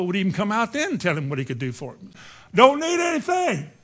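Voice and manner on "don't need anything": high, raised acted refusal; dismissive reply. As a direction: call it out to wave someone away. Prophet's curt reply, acted.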